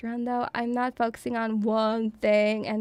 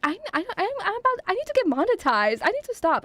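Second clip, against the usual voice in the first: flustered rush; 7 semitones higher, faster than usual. Real, not acted. Flustered self-stop.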